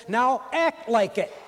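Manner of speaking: Paul's charge to the church, loud and slow; emphatic command. Retold or imitated. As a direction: drive the charge home, slowly. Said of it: "And Paul says".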